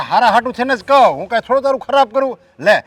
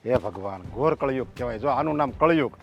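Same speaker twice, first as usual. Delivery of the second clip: a low, much softer groan of despair to God; despairing lament; 7 semitones lower, 7 dB quieter than usual. Acted. Father bemoaning the dark age to God, hushed and despairing. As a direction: mutter it like a broken old man calling on God.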